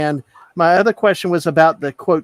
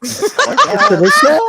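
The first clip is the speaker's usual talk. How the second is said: slow, high, laughing Southern drawl; 4 dB louder, much slower than usual. Shown as a demonstration. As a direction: stretch it out Southern style. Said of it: "You should say".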